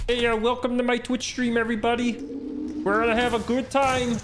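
high, steady frog puppet host voice; Kermit the Frog impression. Impersonator welcoming Twitch viewers, Kermit impression. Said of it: "Kermit the Frog impersonator".

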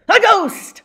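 high, loud shout of surprise; excited reveal. A narrator's reading. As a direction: cry out the big reveal. Ghost reveal in story.

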